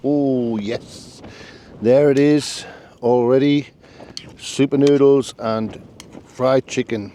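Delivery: loud delighted cheer; thrilled the food is done; excited. Real, not acted. Food finally ready, excited.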